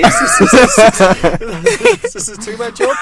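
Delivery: squealing with laughter, very high; laughing. Real, not acted. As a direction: laugh through the words, pitch way up. Laughing hard while blowing party horn.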